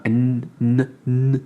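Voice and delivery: "n" stopped halfway, no vowel after; clipped N. Shown as a demonstration. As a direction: cut the "n" short before it ends. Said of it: "on s'arrête avant la fin du N". (N coupé avant la fin)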